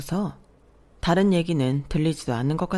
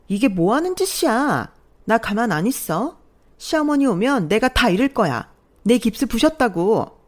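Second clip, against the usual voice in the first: higher, louder panicked accusing; shrill accusation; 5 semitones higher, 5 dB louder than usual. Acted. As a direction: accuse in a frantic rush. Sister-in-law panics, accuses narrator. (다급하게 몰아붙이듯)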